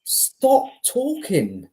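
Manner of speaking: high, slow, stern stop; mock command. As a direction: order him to stop, drawn out. Telling manager to stop.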